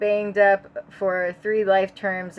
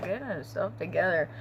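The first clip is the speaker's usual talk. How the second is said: plain reading voice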